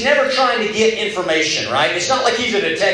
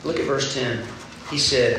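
ordinary talk

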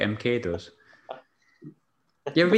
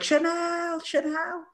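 Scouse lass calling her parrot; much slower than usual. Retold or imitated. Scouse owner calling out for her lost parrot, high yelling. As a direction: yell the parrot's name like a frantic Scouse owner. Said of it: "she's screaming from in the street".

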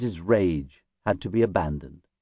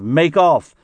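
raised, higher shout to flee; urgent shout; 6 semitones higher, 6 dB louder than usual. A narrator's reading. Panicking crew urge each other to escape, urgent shout. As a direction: call it out over the crew. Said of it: "With shouts of".

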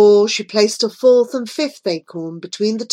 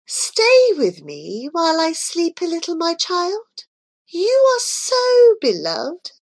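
tender; 9 semitones higher than usual. A narrator's reading. Queen tender before sleep.

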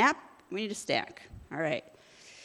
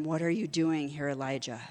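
low, soft and quiet, trailing off on Elijah; God's quiet voice asks Elijah after the fire; gentle whisper of God; 7 semitones lower, 6 dB quieter than usual. Acted. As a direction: ask it gently, like a still small voice. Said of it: "came a gentle whisper".

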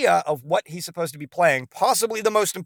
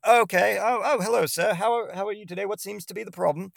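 cheery doctor; 6 semitones higher than usual. Retold or imitated. Doctor restarts the improv bit.

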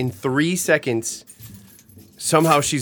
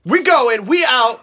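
hyped; 9 semitones higher, 6 dB louder, slower than usual. Pretend. Hyping the vacation booking.

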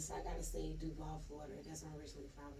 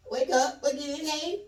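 mock wake-up call; 8 semitones higher, 12 dB louder than usual. Retells waking him mid-argument.

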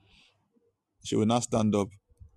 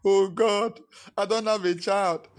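louder, higher pleading to God; mock pleading prayer; 6 semitones higher, 4 dB louder than usual. Pastor mimics a childless believer begging God, louder. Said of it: "emotional blackmail".

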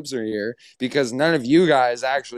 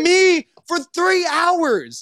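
high, loud wail of protest; indignant outburst; 5 dB louder than usual. Real, not acted. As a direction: cry out the injustice at a high pitch. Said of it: "that made me so mad".

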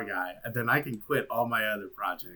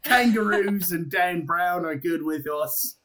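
put-on Australian accent; 6 semitones higher than usual. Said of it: "with an Australian accent".

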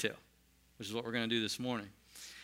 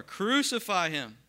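higher, louder, slow shout; crowd shouting; 7 semitones higher, 6 dB louder, slower than usual. Acted. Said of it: "they shouted all the more".

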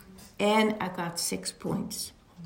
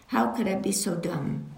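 ordinary talk

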